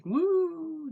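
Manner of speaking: high, loud happy whoop; excited woo. Retold or imitated. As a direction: whoop with delight. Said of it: "just happy, excited".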